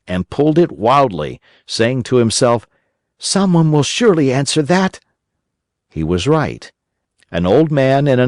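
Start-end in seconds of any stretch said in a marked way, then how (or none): 3.2-5.0 put-on higher boyish puppet voice, anxious; a desperate wooden boy hoping; Pinocchio's voice. A narrator's reading.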